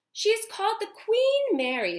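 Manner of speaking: high, loud put-on voice for the father; father's voice. A narrator's reading. Teacher reading the father's line to class, high and loud. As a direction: announce it grandly as the father.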